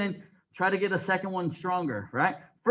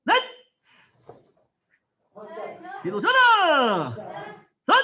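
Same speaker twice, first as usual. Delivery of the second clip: loud Korean count, each number punched out; shouted drill count; 7 semitones higher, 4 dB louder, faster than usual. Real, not acted. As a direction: call every count like a drill leader. Instructor shouting counts during drill.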